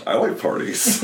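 mock-eager party girl, high and soft; playful Sally. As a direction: toss it off with a giggle. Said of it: "Sally's like".

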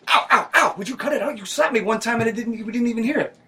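high pained yelping; just got slapped. Acted. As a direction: yelp and whine, being slapped.

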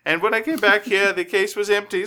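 mock soprano telling of the theft; opera diva. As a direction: squeal it like an upset prima donna. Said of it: "Signora Castafiore, and is going".

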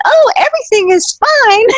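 high, falsely cheerful onstage voice; pretending all is fine. Ballerina hiding her pain on stage, high fake cheer. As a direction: sing out a fake everything's-fine with a smile. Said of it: "smiling and pretending".

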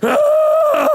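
high, loud roaring shout; God spreads his chest and roars like a monster. Retold or imitated. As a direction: bellow like a giant beast. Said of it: "spread his chest and went".

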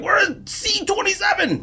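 high-pitched mock yell, drawn out; imitated yelling. Angry passenger hunting for his assigned seat, high mock yell. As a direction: mimic an indignant passenger yelling. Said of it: "I mean, he was yelling".

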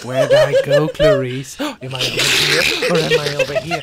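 loud, hammed-up hide-and-seek taunt; mock Hannibal Lecter. Comic plays Lecter hiding from Clarice, loud mock taunt. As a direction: play a silly villain, loud and half laughing.

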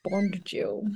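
slow, suave Bond-style introduction; mock spy voice. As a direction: play the spy, drawing out each word. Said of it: "best shit spy accent".

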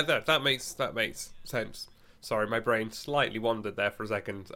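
usual speaking voice

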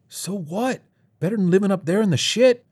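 high-pitched, quickening comeback; defiant retort. Acted. Kid argues for the bunker, high and defiant. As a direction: toss back a high, heated challenge.

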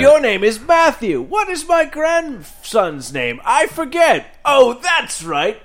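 put-on high, slow, doddering old grandpa; old man voice. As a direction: play a forgetful old man, drawn out. Grandpa forgetting grandson's name.

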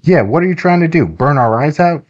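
put-on high-pitched boy's protest; lightly acted indignant boy. A narrator's reading. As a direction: pitch it up, complaining like a kid.

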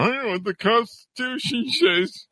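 high, put-on voice of a smug know-it-all; mocking know-it-all. Mocking know-it-all parrot heads.

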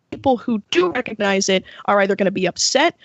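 ordinary talk, nothing marked

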